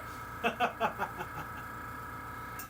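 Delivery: long chuckle trailing on; a string of quick, high giggles; real laugh. Real, not acted. Player laughing about her half-painted character, real laugh.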